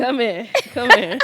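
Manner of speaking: loud, rising preacher call. Pretend.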